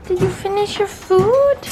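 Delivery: high sing-song pet voice; talking to a dog. Real, not acted. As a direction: coo at the dog.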